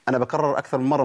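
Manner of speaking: ordinary delivery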